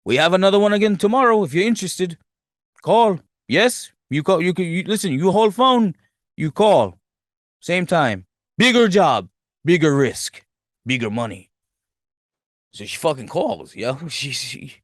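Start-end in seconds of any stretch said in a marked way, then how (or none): 0.1-11.5 put-on lightly accented, fast broken English; a hint of a foreign boss pushing a deal; mock accent. Theo Rossi's character offering a bigger job, lightly accented. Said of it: "Theo Rossi is like".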